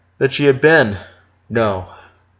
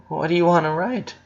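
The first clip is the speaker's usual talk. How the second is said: lightly voiced mother; 7 semitones higher than usual. A narrator's reading. Mother asking her son.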